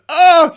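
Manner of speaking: high, loud "oh" imitating a child; mock disgusted cry. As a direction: imitate a twelve-year-old's revolted yelp. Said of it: "she went".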